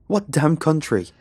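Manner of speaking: high, loud, exasperated question; angry demand. A narrator's reading. Interrogator repeats demand.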